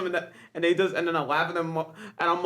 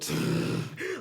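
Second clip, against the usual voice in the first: very high, breathless wheezing laugh; imitating car engine laugh; 14 semitones higher, 3 dB quieter than usual. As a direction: copy a friend's strange wheeze of a laugh. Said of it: "he makes a car engine noise".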